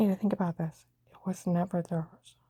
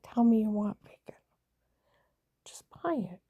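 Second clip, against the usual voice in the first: whispered coaxing; 3 dB quieter than usual. Retold or imitated.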